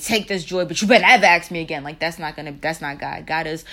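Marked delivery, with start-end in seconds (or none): 0.0-1.8 mock God snapping, much louder and fast at the end; half-hearted mock scolding giver; grudging God. Imagined God handing out joy, mock scolding. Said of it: "He's gonna, like".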